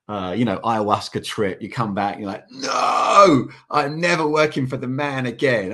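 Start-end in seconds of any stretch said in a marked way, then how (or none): 2.5-5.7 higher and louder, a hammed-up declaration; mock defiance. Back from a trip, vowing never to work again, mock defiant. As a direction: play the fired-up convert quitting the rat race. Quoting changed post-trip self.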